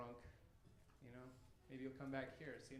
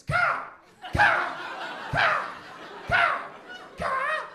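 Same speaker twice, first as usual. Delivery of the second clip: loud, high crow caws; 17 dB louder, much slower than usual. Retold or imitated. Crows on the roof waking the sleeper, loud cawing. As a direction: squawk like a crow. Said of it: "six crows on the opposite roof".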